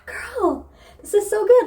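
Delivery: high and loud, delighted; excited gush. Retold or imitated. Reader gushing to the book, loud and delighted. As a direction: burst out to a friend, thrilled. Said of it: "And I was like".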